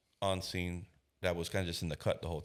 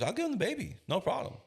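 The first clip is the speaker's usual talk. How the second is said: agreeable mother. Retold or imitated. Mother's quick agreement voiced.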